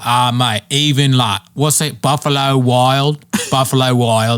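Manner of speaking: mock Australian groping for a name, slow; slowed Australian bloke searching for words; Australian accent. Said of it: "he was like".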